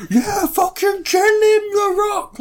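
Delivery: very high, drawn-out strained yell; hammed-up American wrestling fanatic; mock fan scream. Imitating an American fan screaming for The Rock, high slow yell.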